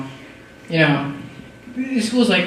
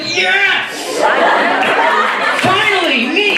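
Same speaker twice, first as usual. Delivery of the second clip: much higher, gleeful exclamation; mock joyful cheer; 12 semitones higher, much slower than usual. [name] voicing his relief at school, gleeful high cheer. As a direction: cheer like escaping chores. Joy at free time at school.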